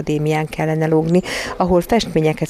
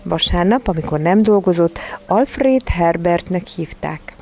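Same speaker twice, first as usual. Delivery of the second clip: ordinary delivery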